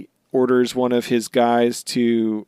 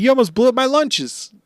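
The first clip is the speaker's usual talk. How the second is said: mock outraged Luffy; 12 semitones higher, 3 dB louder than usual. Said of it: "he's like".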